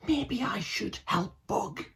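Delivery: high, soft and slow whisper. Acted. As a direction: hush it so the teachers won't hear. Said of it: "whispered Toby".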